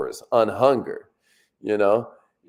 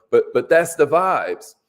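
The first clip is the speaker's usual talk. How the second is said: ordinary talk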